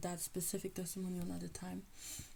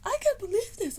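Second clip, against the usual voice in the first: astonished squeal; 7 dB louder than usual. Retold or imitated. Student squealing back at the teacher's kind words, high and loud. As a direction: squeal it like you can't believe your luck. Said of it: "which was like".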